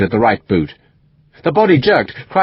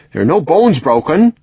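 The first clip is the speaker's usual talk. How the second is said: put-on West Country accent; 6 semitones higher than usual. A narrator's reading. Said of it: "strong West Country accent".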